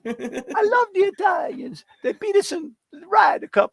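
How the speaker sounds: put-on high laughing caricature Italian accent; mock Italian accent. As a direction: go full cartoon Italian, grinning. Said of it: "You do Italian".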